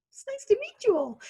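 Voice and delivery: very high, squeaky sing-song parody; childish high mock of the greeting; mocking chirpy greeting. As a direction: tease it the way the kids do, high and sweet. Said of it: "my children still tease me".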